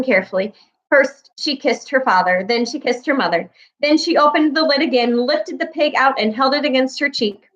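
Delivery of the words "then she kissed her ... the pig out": ordinary delivery, nothing marked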